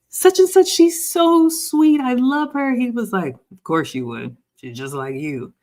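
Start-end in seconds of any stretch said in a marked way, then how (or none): 0.1-2.8 put-on high, loud, slow gushing over his girlfriend; imitating her own gushing to her son; gushing mom. 3.5-5.5 deadpan. Retold or imitated. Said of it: "he said it just like that".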